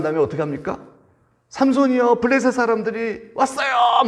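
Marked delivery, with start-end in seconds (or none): 1.5-4.0 high, loud cry of warning; shouted alarm. Acted. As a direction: call out the signal to the soldiers. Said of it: "막 이 소리를 지르는 거예요".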